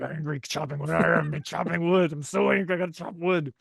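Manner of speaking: mock angry brute. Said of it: "Who's like".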